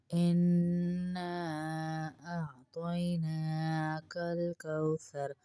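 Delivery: chanted, drawn-out tajweed recitation; measured Quranic chant; slow Quran recitation. Shown as a demonstration. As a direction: recite the verse slowly and melodically. Recites the verse slowly.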